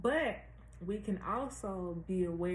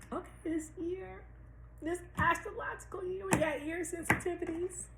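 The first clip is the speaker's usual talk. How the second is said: high-pitched; 11 semitones higher than usual. Real, not acted. Sudden high voice.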